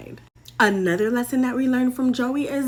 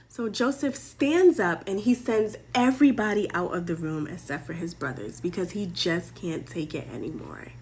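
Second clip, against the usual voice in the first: ordinary delivery, nothing marked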